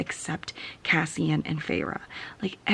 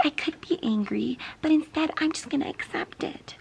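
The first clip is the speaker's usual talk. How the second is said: mocking Feyre; 9 semitones higher than usual. Mocking Feyre's passive acceptance.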